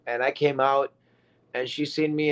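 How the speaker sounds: ordinary delivery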